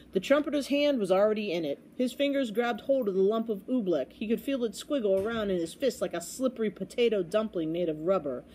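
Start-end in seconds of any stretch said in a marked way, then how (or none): none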